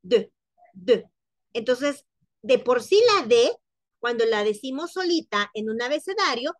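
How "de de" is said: "de" with a closed, rounded vowel. Shown as a demonstration. Teacher drills the closed "de". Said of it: "más cerradito y decimos de".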